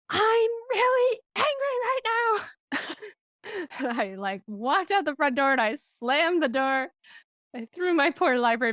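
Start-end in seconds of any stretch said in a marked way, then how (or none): 0.1-2.8 high, strained mock yell, drawn out; retold angry yell. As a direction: re-enact a yell, stretching each word high. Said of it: "but I yelled".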